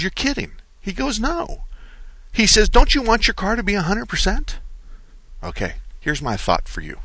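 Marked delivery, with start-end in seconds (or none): 0.0-4.6 high, lightly voiced incredulity; incredulous back-and-forth. Host acting out customer and manager, higher. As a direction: pitch up, play both sides. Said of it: "And the customer says".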